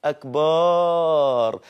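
أكبر drawn out slowly as "akbaaar"; added alif. Shown as a demonstration. Teacher exposing the extra alif after ba, wrongly lengthened. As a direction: stretch the ba of أكبر into a long aa. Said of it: "عملت بعد الباء ألف".

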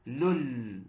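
"لول" stretched on its final l; lām demonstration. Shown as a demonstration. Teacher repeats the sound for students, "لول" drawn out. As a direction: sound out "لول" slowly, alone. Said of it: "الصوت اللي أنا بقوله ده".

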